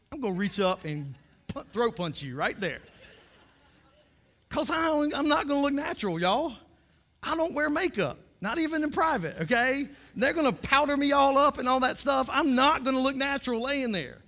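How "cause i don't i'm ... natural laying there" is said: higher, louder indignant outburst; fired-up comic indignation; worked-up rant. Real, not acted. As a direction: protest it, voice up and loud. Heated rant about looking natural.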